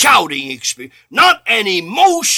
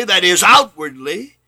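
ordinary delivery

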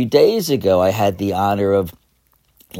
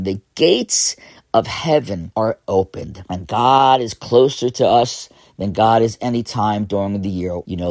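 normal talking voice

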